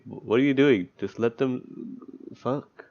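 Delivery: ordinary delivery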